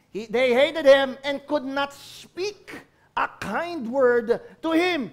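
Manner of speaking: slow, emphatic scripture reading; preaching. Acted. Preacher reads how brothers treated Joseph, slow and emphatic. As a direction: read it slowly with weight. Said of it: "emphatic scripture reading".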